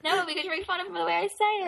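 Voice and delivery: high, quick protest. Real, not acted. Refusing while being teased.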